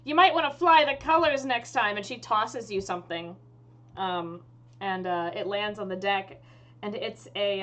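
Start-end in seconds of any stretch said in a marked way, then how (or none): 0.0-2.0 loud, higher parting shot; raised in-character pirate voice; pirate captain. Acted. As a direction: call back a pirate's advice. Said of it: "she turns back to you, Will, and she says".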